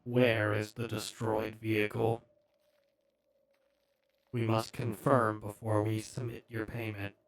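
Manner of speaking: slow, quiet, scrambled voice; a cold anonymous voice through a voice changer; distorted voice. Acted. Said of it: "you hear a very distorted voice".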